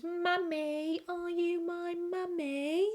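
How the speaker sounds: put-on high, childlike eerie plea; high creepy child asking for mummy; creepy kid voice. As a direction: ask like a lost ghost child. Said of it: "he goes".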